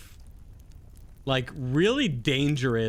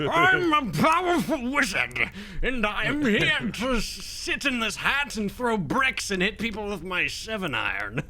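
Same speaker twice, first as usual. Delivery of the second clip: gnome wizard voice; 6 semitones higher than usual. Retold or imitated. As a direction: puff up as a pompous little wizard. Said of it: "he has that kind of voice".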